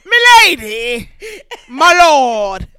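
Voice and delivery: loud, slow courtly greeting; hammed-up royal court address; old English lord address.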